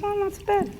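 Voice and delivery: soft, high encouraging. Retold or imitated. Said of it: "she looks at me and goes".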